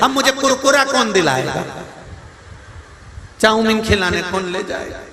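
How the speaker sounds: mock crying. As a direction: lament like a little boy missing treats. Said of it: "वो रो रहा था".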